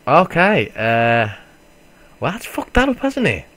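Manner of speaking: high, loud exasperated okay; dismayed surprise. Real, not acted. Gamer sees the route ruined, high loud dismay. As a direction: voice jumps up in dismay.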